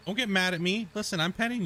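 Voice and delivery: pet talk. Real, not acted. Soothing the grumpy cat.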